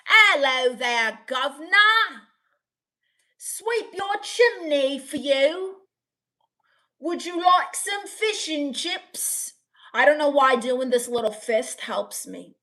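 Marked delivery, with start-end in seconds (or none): 0.1-9.5 high, drawn-out cartoon British; British accent. Shown as a demonstration. Streamer shows chat a British accent on request, hammed-up British. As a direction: ham up a slow Cockney British accent. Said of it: "Can you do British ones?".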